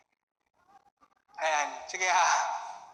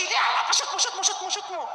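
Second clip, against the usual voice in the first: high, laughing hyped cheer; pure energy; 8 semitones higher, 3 dB louder than usual. Acted. Mister Pure Energy hyping you to shoot, high and excited. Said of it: "Mister Pure Energy".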